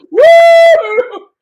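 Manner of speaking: loud high woo; cheering whoop. Real, not acted. Host celebrating the giveaway win with a whoop. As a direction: let out a big whoop. Cheering after winner drawn.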